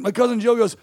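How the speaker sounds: ordinary talk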